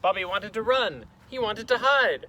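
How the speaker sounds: loud, high, dramatic storytelling; panicky narration. A narrator's reading. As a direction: heighten it as if wanting to flee.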